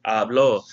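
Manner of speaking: Habló stressed on its last syllable; stress on final O. Shown as a demonstration. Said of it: "with an accent on the O".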